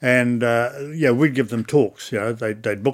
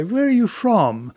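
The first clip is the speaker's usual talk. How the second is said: high, slow and eager; chatty guide asking tourists where they're from; 10 semitones higher, 4 dB louder, slower than usual. Retold or imitated. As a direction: greet the visitor keenly. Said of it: "you talk like this".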